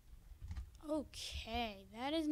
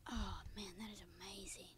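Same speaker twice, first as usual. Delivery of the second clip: breathless whisper of wonder; a reverent whisper to himself; whispered awe; 4 semitones lower, 10 dB quieter than usual. Real, not acted. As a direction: hush it like you can't believe it. Admiring house right after.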